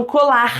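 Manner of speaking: COLAR ending in a breathy h-like R; glottal R. Shown as a demonstration. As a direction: breathe out the final R of COLAR from the throat. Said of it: "o R é chamado de glotal".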